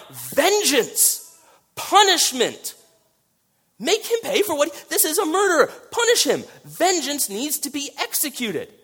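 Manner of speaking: high, loud, demanding cry for punishment; crying for vengeance. Acted. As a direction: voice the blood that screams for revenge. Voicing Abel's blood crying for vengeance.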